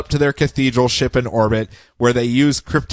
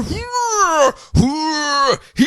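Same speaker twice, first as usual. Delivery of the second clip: high, drawn-out mock wails of effort; straining cries; 12 semitones higher, much slower than usual. A page of Superman straining, hammed-up high effort cries. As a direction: squeal with pretend exertion. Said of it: "a page of him just going".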